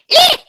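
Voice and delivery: sudden piercing eek; startled squeak; high squeal. Real, not acted. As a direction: let out a shrill little shriek of shock. Sudden squeal reacting to text.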